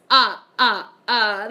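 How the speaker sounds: loud staccato vampire laugh; the Count. Acted. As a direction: punch out three mock-villain ah's. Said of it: "Oh, like the Count?".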